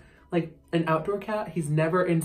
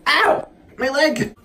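loud, high cries of pain at the cat; real shout of pain, ow, my leg; 7 semitones higher, 9 dB louder, slower than usual. Real, not acted. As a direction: yell as claws sink into your leg. Cat clawing her leg.

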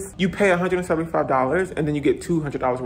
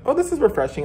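pleasantly surprised; 8 semitones higher, faster than usual. Retold or imitated. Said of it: "And I was like".

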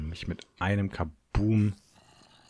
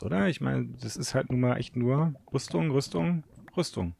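ordinary talk

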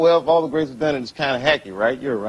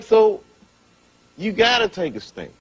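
normal talking voice

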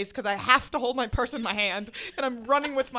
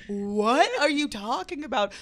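louder, slow mock bafflement; retold shout back; 4 semitones higher, 5 dB louder, slower than usual. Answering friend down the train, raised slow disbelief. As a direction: call it back slowly, raised and incredulous.

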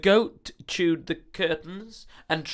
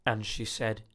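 ordinary delivery, nothing marked

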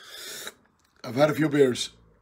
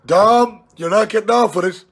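loud and higher, a cheeky dare; teasing shout; 5 semitones higher, 4 dB louder than usual. Real, not acted. Throwing the pint challenge at Tom, loud and teasing. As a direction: shout it at him so he hears. Daring his pal, raised voice.

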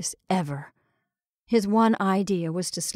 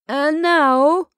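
a hint of a showman's call, up and loud; ringmaster announcing; 7 semitones higher, 7 dB louder than usual. Ringmaster addressing the costume ball, announcing. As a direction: call the room to order. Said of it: "continued the ringmaster".

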